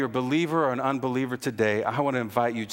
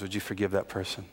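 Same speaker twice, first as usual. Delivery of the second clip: much softer on the question, pulling back; soft probing question after the offer; dropping to a hush; 5 semitones lower, 6 dB quieter than usual. Real, not acted. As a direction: ask it quietly, letting it sink in. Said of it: "If you were given fifty thousand".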